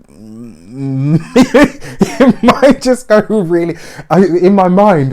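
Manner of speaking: high, loud words through laughter; laughing helplessly. Real, not acted. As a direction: crack up as you picture it going wrong. Cracks up picturing the disaster.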